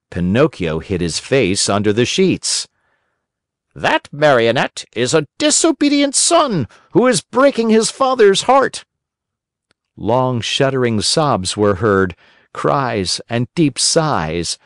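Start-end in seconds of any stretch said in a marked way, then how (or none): 3.7-8.8 much higher, reproachful squeak; accusing cricket, very high. A narrator's reading. Cricket shames Pinocchio before the fairy, very high. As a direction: shame the puppet in a piercing cricket voice.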